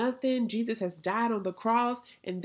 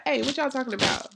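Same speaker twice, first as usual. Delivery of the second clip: lightly acted casual butting-in, higher; Jesus; 5 semitones higher, 4 dB louder, faster than usual. Risen Jesus to walkers on the road, casually curious. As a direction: ask quickly, like a nosy pal. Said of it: "he was like".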